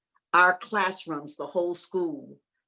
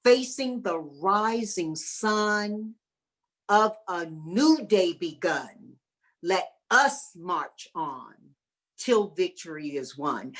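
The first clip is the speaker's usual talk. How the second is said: slow recitation; slower than usual. Retold or imitated. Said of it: "the part that we had to write on was".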